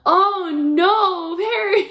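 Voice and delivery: high, loud cry of dismay. Retold or imitated. Wife reacting to husband's wrong turn, loud dismay.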